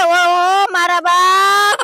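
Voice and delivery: drawn-out cry. Acted. Husband cries out in dismay.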